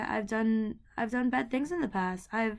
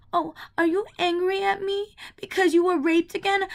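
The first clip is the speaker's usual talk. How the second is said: higher, taunting mom imitation; mocking mom; 8 semitones higher, 4 dB louder than usual. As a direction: jab at her like a cruel mother. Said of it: "and she goes".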